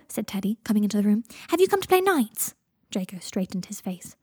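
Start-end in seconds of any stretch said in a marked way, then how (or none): 1.5-2.6 put-on high, loud little-boy voice; high-pitched, hopeful child's question; young child. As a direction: ask eagerly like a small child. Child Teddy asks to play.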